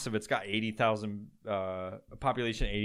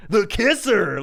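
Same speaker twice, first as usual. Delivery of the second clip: louder, higher drawn DJ call; radio DJ voice; 7 semitones higher, 9 dB louder, slower than usual. Retold or imitated. Mocking the KISR DJ, louder higher station call. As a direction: punch the name like a station ident. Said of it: "this weird fucking voice that they all do".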